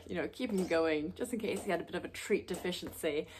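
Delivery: ordinary talk, nothing marked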